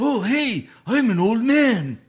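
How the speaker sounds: high, hammy fake old man; young guy faking an old man; bad old man voice. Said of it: "sounds like a young guy trying to do an old man voice".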